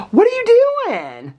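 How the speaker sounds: playful shocked question to the dog; surprised pet-talk. Real, not acted.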